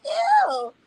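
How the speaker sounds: a short, high squeal of disgust. Retold or imitated. Telling the boyfriend his back hair is gross, high disgusted squeal.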